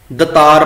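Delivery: ਦਤਾਰ said da-taar with short first a; dropped long vowel. Shown as a demonstration. Teacher listing our mistakes, ਦਤਾਰ begun short. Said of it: "ਕੰਨਾ ਅਸੀਂ ਛੱਡ ਹੀ ਦਿੰਨੇ ਆ".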